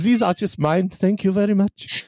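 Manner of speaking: high, hammed-up made-up Genovian accent; a silly royal accent, well up; mock Genovian accent. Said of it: "it's my Genovian".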